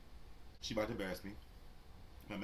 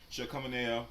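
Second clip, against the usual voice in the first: normal talking voice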